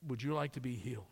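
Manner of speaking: soft, low, kind question; Jesus asks the invalid softly if he wants healing; gentle Jesus voice. Acted. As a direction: offer healing quietly.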